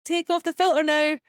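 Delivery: high half-laughing demand; nervous order. Retold or imitated. Friend spooked by demon filter, high nervous demand. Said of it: "And they're like".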